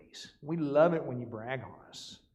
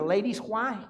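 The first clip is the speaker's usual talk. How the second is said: ordinary delivery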